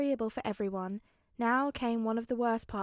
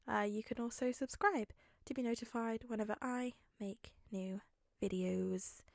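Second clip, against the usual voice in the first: whisper; 4 dB quieter than usual. Real, not acted. Whispered subscribe reminder.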